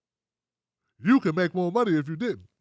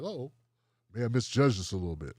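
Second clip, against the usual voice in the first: much lower, sheepish backpedal; sheepish company; 11 semitones lower, 5 dB quieter than usual. Retold or imitated. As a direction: shrink back with a low mumble. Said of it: "They was like".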